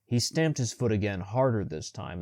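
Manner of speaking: ordinary delivery, nothing marked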